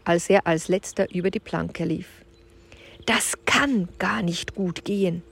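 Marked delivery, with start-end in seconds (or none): 3.1-5.2 murmured. Acted. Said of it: "murmelte Julian".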